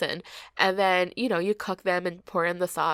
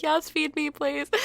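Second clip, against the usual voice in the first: higher, playful pleading for food; mock desperate begging; 8 semitones higher, slower than usual. Daughter smelling dad's cooking, mock pleading. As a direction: beg like a starving child, hammed-up. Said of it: "I'm like".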